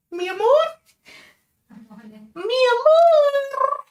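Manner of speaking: high, drawn-out calling out mi amor; sing-song greeting. Real, not acted. Greeting his partner who walks in, high and drawn out. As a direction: stretch amor long and high like a fond call.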